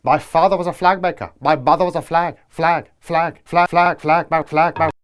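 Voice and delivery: glitching robot stuck on a loop; malfunctioning robot. Acted. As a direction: repeat like a broken machine.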